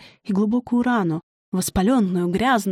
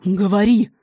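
barked command, louder; harsh demand; 5 dB louder than usual. A narrator's reading. Dema looming over the girl, harsh command. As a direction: growl the order at her.

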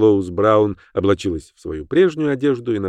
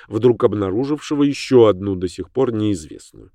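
ordinary delivery

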